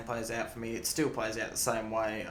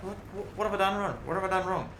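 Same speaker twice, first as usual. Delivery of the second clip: put-on much higher, indignant kid's outcry; baffled protest; 9 semitones higher than usual. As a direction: cry out as if wrongly punished. Kid protesting to teacher.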